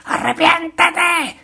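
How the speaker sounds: high, slow hammed-up cry of a ranting preacher; mock preacher yell. Preacher mocking noisy preachers with a hammed-up, drawn-out high yell. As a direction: bellow it like a caricature of a hellfire preacher.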